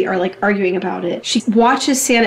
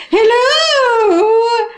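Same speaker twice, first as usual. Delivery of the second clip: high, drawn-out hello; hammed-up high-pitched hello; tipsy wife answering; 12 semitones higher, 3 dB louder than usual. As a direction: sing out a long phone greeting. Acts wife answering the phone.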